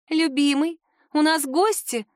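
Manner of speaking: high, sweet chirpy princess voice; chirping. A narrator's reading. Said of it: "прощебетала она".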